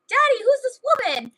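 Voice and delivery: put-on high-pitched child asking Daddy; kids' voices. Kids confronting their dad about a strange woman, high child voice. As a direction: pipe up like a puzzled kid. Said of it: "their two kids went outside".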